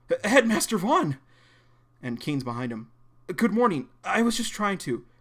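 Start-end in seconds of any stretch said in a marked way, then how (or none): 0.1-1.2 high, loud, caught-out exclamation; startled stammer. Acted. Startled at seeing the headmaster. 3.3-5.1 high, nervous, hurried explaining; flustered excuse. Acted.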